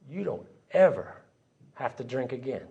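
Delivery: hushed, halting inner voice; soft retold revelation; sudden quiet realization. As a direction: let the thought land softly, with pauses. A thought arriving in his head.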